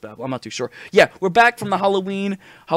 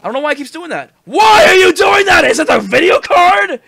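frustrated shout; 12 semitones higher, 10 dB louder than usual. Real, not acted. Yelling at broken sound.

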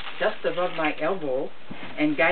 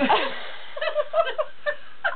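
helpless high laughter. Real, not acted. Breaks down laughing mid-story.

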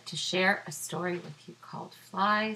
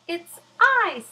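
high, loud squeaky fly; a tiny fly answering proudly; 11 semitones higher, 14 dB louder than usual. Acted. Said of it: "said the fly".

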